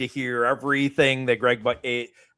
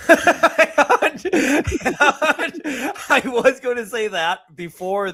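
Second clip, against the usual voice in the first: high burst of laughter into words; laughing; 12 semitones higher, 4 dB louder, slower than usual. Real, not acted. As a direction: laugh through it, much higher. Laughing at a friendly dig.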